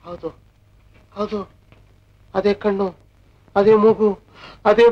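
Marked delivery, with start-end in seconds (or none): none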